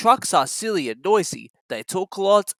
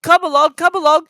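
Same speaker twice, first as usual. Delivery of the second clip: high, louder happy puffing; 11 semitones higher, 8 dB louder than usual. A narrator's reading. Thomas urging the trucks on, puffing happily. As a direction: chuff along happily. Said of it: "he puffed".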